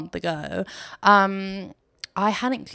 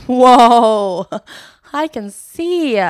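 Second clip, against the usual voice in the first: loud amazed whoa, then higher and slow with a laugh; 3 semitones higher, 10 dB louder, much slower than usual. Retold or imitated. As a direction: gasp in delight at seeing clearly. Amazed at clean mirror.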